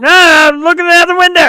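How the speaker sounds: loud, high mock denial; defensive cover-up. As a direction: blurt an excuse to hide the tears. Acts out hiding tears from dates.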